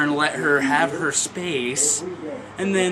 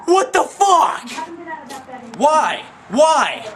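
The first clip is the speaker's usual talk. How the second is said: outraged; 9 semitones higher, much slower than usual. Real, not acted. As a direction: blurt it in disbelief. Outrage she got out.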